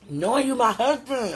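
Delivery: high, loud burst of feeling; exasperated exclamation. Real, not acted. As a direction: cry it out at a loved one. Repeats it as an exclamation to sweetheart.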